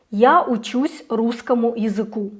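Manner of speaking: usual speaking voice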